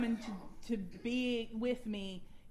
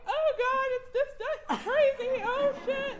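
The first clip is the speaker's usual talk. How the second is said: high, loud, half-laughing panic; frantic retold panic; 6 dB louder than usual. A bride panicking on the drive back, high and laughing. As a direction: squeal it out like a stressed bride. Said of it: "I'm like".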